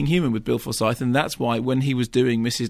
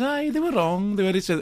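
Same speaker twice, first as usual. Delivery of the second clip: very high, hammed-up Scottish accent; mock a bad Scottish accent, pitched right up; Jenny Seagrove impression; 8 semitones higher than usual. Film critic mimics the actress correcting her suitor, bad high Scottish accent. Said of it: "impression of Jenny Seagrove doing a bad accent".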